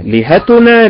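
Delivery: second way to say لهتنا. Shown as a demonstration. Teacher showing three ways to stress a word. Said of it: "تنطق بثلاث طرق".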